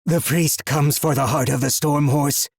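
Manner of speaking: possessed seer shouting; hoarse monotone shout. Acted. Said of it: "he shouted in a hoarse, monotone voice".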